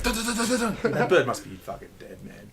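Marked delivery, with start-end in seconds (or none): 0.0-0.9 high, rapid "dun dun" noises; imitated pecking. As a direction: mimic relentless pecks. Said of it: "they just kept like".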